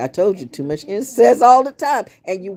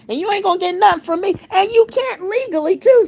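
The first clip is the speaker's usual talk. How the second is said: heated outburst; 13 semitones higher than usual. Real, not acted. Ranting at the debt collector, fast and high-pitched. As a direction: pitch shoots way up, fast and fired up.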